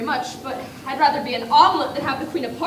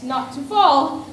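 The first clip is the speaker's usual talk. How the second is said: ordinary talk, nothing marked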